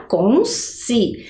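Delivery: consciência said slowly, syllables counted out; counting syllables. Shown as a demonstration. Teacher counting syllables with pupils, slow. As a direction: pace out consciência beat by beat. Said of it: "Vamos contar lá?".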